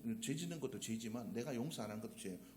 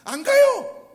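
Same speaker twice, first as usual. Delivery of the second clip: much louder, half-shouted punchline; exasperated outburst; 21 dB louder, much slower than usual. Retold or imitated. Preacher voicing a fed-up wife, loud exasperated shout. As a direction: blast out the complaint like a fed-up wife. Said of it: "아유, 저거 빨리 어디 갔으면 좋겠는데".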